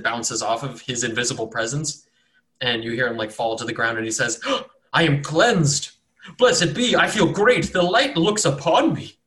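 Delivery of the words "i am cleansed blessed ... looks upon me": higher, louder, rapturous cries; overjoyed at being cleansed; ecstatic cultist. Acted. Struck cultist crying out in rapture to the cleric.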